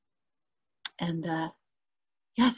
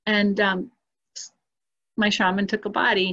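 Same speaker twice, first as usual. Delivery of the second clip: ordinary talk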